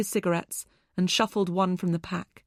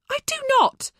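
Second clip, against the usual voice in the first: high, loud, snapping back; indignant denial; 6 dB louder, faster than usual. A narrator's reading. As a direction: fling the denial back at him.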